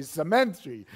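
"cemetery" as se-MEN-tree, loud and amused, Filipino style; Filipino-accented "cemetery", MEN punched. Retold or imitated. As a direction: imitate Filipino second-syllable stress on "cemetery". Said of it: "but they say cemetery".

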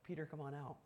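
soft, gentle invitation; very quiet, calm beckoning; gracious. Acted. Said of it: "Jesus graciously says".

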